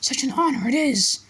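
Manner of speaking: a tiny elf gushing; high-pitched elf voice. A narrator's reading. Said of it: "in a high-pitched voice".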